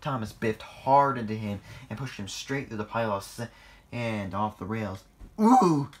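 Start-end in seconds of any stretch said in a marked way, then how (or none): 5.4-5.9 high, loud "ooh"; startled cry. A narrator's reading. Engine knocked off the rails, crying out. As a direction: yelp out a sudden cry. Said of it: "cried Harry".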